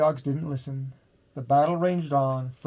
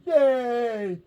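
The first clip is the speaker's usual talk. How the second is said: cheering; 8 semitones higher than usual. A narrator's reading. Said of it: "the crowd cheered".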